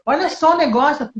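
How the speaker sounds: ordinary talk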